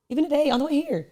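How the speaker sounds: excited. Real, not acted. Excited retelling of rush.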